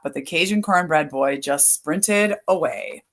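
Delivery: ordinary delivery, nothing marked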